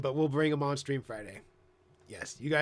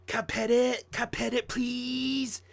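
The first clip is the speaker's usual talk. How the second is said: put-on high, eager little-kid plea; little kid begging; 10 semitones higher, 3 dB louder than usual. Streamer acting a kid begging to pet a dog, high and eager. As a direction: beg to pet the dog like a small child. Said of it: "resort back to being like a little kid".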